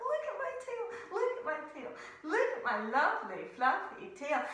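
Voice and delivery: sing-song bragging with a laugh; lightly voiced vain bear; proud bear showing off. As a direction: flaunt it with glee. Bear showing off her tail.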